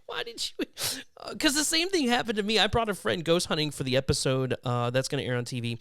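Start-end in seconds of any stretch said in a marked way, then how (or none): 0.0-0.7 squeaky, very high incredulous question; hammed-up bewildered squeak; mock disbelief. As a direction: ask it in a high, baffled put-on voice. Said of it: "I'm like".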